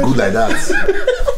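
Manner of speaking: high, slow, stretched through a laugh; laughing sing-song. Real, not acted. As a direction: sing it out while laughing at him. Mocking praise while laughing.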